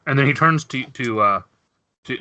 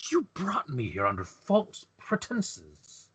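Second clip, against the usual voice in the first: accusing Chulari; 2 semitones higher, 8 dB quieter, slower than usual. Acted. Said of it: "Chulari turns and says".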